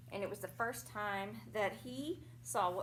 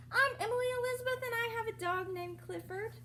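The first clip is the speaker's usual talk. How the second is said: put-on much higher, quick girlish read; lightly voiced young girl introducing herself; little girl voice; 11 semitones higher, faster than usual. A narrator's reading. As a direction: chirp it like a small proud girl. Reading girl narrator's line.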